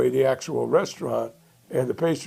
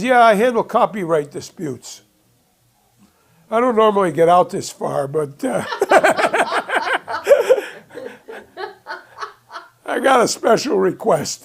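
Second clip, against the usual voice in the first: laughing through it; 7 semitones higher, 5 dB louder than usual. Retold or imitated. Lawyer explains himself, laughing.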